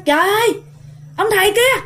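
grandmother shouting at the monk over the wall; shocked shout. Retold or imitated. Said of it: "Bà ngoại mới nói".

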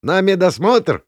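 high, loud heckle; shouting out. A narrator's reading. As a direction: yell it out from the crowd. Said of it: "выкрикнул кто-то". (выкрик из толпы)

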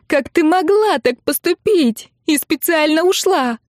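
high-pitched accusing outburst; angry wailing. A narrator's reading. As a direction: wail the accusation in outrage. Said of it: "ещё громче завопила сестра".

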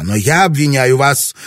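wife shouts back at her husband, loud; shouted back. A narrator's reading. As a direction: hurl it back at him. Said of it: "крикнула она в ответ".

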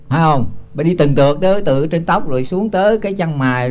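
ordinary talk

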